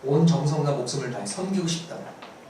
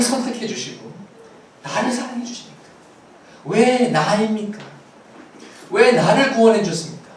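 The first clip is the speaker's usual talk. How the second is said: loud, raised cries of "why"; impassioned preaching; 3 semitones higher, 5 dB louder than usual. Real, not acted. Preacher marvelling before congregation at being chosen, impassioned preaching. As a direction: drive each question out forcefully, then ease off. Preacher's climactic rhetorical questions.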